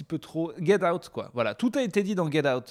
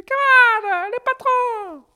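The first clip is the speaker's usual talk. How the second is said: very high, loud, squeaky call to comrades; rally the troops in a tiny high voice; high squeaky union leader shouting; squeaky rallying cry; 10 dB louder, slower than usual. Retold or imitated. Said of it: "le mec qui est comme ça".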